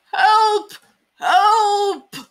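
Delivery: loud high panicked yell; crying for help. Acted. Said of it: "cried the old mouse".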